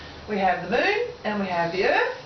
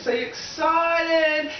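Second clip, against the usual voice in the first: "excited" shouted out high, slower; 5 semitones higher, slower than usual. Shown as a demonstration. Speaker to audience, shouting "So you're excited" with delight. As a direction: burst out with "So you're excited" to fire up the room.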